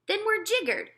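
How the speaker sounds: high exasperated cry; cried out. Acted. Marshal giving up on the plan, high exasperated cry. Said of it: "cried the Air Army Marshal".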